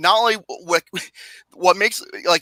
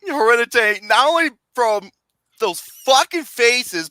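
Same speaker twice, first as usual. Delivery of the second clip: ordinary delivery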